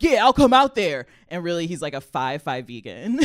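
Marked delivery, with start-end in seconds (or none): 0.0-1.0 loud, high mock macho call; hammed-up roommate bravado; tough-guy bluster. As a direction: puff up like a tiny vegan playing tough. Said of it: "guy roommate, Chris, is like".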